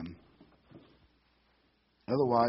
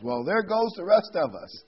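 high, loud call from the audience; acted heckler's yell; 8 semitones higher, 8 dB louder than usual. Heckler to MC at lawyer banquet, mock yelling. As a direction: call it out over the crowd, playfully. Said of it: "Somebody from the audience yells".

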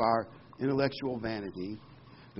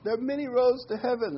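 high, lightly louder mockery; mocking quote; 11 semitones higher, 4 dB louder than usual. Preacher parodying popular religion's slogan, high and mocking. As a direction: parody their glib slogan. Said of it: "they say".

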